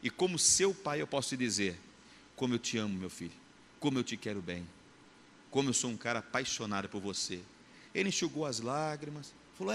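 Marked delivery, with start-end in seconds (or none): 2.4-7.4 tender father. Retold or imitated. Father tenderly telling son love.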